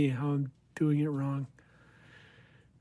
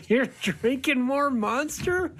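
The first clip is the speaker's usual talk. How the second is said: surprised; 9 semitones higher than usual. Real, not acted. Teasing disbelief at friend.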